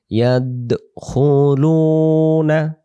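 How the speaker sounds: ইয়াদখুলুনা drawn out slowly, syllable by syllable; slow tajweed recitation. Shown as a demonstration. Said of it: "উচ্চারণ হয়ে যায়". (ধীরে টেনে ইয়াদখুলুনা উচ্চারণ)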